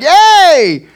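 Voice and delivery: high, loud joyful yay; excited cheer. Retold or imitated. Pastor excited about church with Charity, loud cheer. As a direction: squeal it with delight. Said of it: "I was excited".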